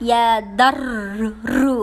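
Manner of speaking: loud ইয়া দার, রু with heavy trills; both R thick. Shown as a demonstration. Teacher models the whole word, heavy ইয়া দার রু. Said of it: "দুবার মোটা হচ্ছে".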